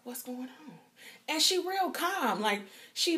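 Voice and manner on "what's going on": put-on soft hushed "Girl", then a whisper; frightened whisper to the nurse; scared whisper. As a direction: whisper it nervously. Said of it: "I'm like".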